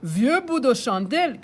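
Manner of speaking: grandpa exclaiming. Acted. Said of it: "crie grand-père Léo".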